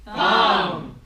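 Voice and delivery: loud low prompt. Acted. Helper shouts the missing word to the giant. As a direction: hit the missing word hard.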